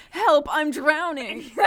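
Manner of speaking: high, slow, laughing cry for help; laughing read. Acted. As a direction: read the line through a laugh. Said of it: "the very next sentence is".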